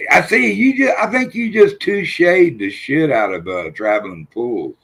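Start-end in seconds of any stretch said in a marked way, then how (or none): 0.1-3.5 excited shout. Real, not acted. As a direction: yelp it out, thrilled. Reacts to a chat comment.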